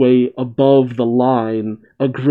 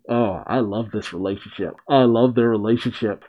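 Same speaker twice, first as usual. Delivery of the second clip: ordinary delivery, nothing marked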